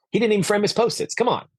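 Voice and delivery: high, quick mock exasperation; incredulous, high-pitched nitpicking; scornful complaint. Retold scornful thought about a speaker.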